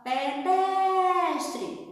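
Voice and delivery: "Pedestre" called out with DES held long and high; calling out, stretched syllable. Shown as a demonstration. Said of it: "a gente vai gritar o nome".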